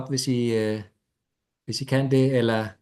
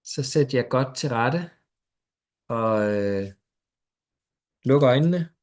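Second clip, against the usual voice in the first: guided meditation. Acted. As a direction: ease them gently into stillness.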